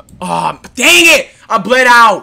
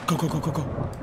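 ordinary talk

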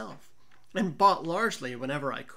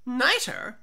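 tipsy Fortunato asking; 5 semitones higher, 5 dB louder than usual. Acted. Drunk Fortunato asks, confused.

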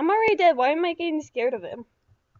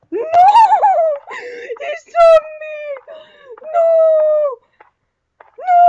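loud, high gamer scream; real high-pitched shouts of alarm; panicked yelling; 13 semitones higher, 13 dB louder than usual. Real, not acted. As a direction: yell in panic as the killer spots you. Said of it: "sorry if I'm yelling too loud".

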